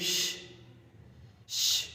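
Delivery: long unvoiced sh hush. Shown as a demonstration. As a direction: hold a quiet sh sound. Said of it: "it makes".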